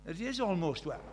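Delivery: usual speaking voice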